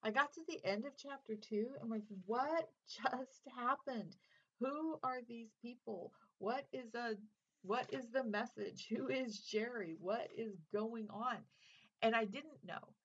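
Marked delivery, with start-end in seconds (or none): 2.3-11.4 higher, slow, baffled questions; clueless bewilderment. Retold or imitated. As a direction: play up being utterly lost, drawing out each question.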